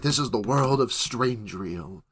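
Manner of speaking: low, slow, hushed narrator voice; slow, deep, soft-spoken narration; narrator voiceover. Acted. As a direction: intone it like a voiceover introducing a world. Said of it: "like, a narrator voice coming over".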